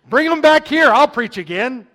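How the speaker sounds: sudden loud, high punchline; loud joking exclaim. Acted. Preacher hamming up an invite to church, loud and playful. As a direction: throw the joke out big. Jokey invitation line in a quote.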